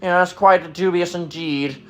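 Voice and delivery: hammed-up pompous sketch voice, starting very high; mock Monty Python toff. Mocking big words nobody uses, pompous Monty Python voice. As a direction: parody an absurdly stuffy intellectual. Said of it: "What are you, a Monty Python skit?".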